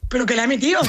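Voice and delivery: ordinary talk, nothing marked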